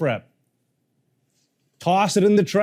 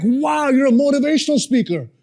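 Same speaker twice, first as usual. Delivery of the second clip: impressed cab driver; 9 semitones higher than usual. Retold or imitated. Cab driver quoted in story.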